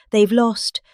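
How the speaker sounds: ordinary talk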